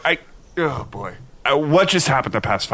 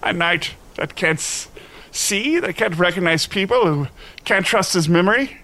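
high, plaintive lament; hammed-up wail of self-doubt; despairing knight; 6 semitones higher than usual. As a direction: bemoan your own uselessness. Knight despairing at being useless.

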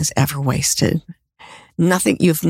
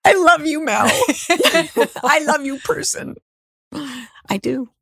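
gushing affection; 9 semitones higher than usual. Real, not acted. Guest saying farewell to Mel and the listener, gushing. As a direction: squeal the goodbye with delight.

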